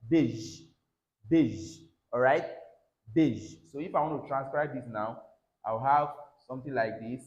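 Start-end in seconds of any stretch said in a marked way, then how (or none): 0.1-3.5 "beige" ending in a zh sound; drilled beige. Shown as a demonstration. Teacher drilling "beige" with a zh ending. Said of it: "So here we have".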